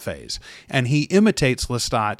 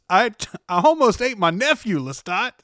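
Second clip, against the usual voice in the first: higher, anguished Louis quote; quoting Louis; 8 semitones higher than usual. Retold or imitated. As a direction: blurt it out like a shaken Louis. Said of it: "and Louis goes".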